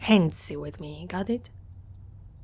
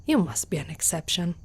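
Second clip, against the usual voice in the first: ordinary talk, nothing marked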